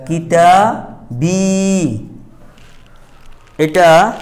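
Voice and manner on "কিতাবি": এস্তেরাইতু কেতাবি drawn out very slowly with long pauses between the words; teacher models the phrase slowly, syllable by syllable; slow Arabic recitation. Shown as a demonstration. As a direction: stretch কিতাবি out for the class to hear. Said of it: "এখন যদি বলা হয়".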